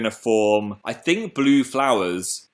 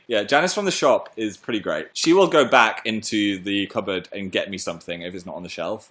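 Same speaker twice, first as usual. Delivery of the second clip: usual speaking voice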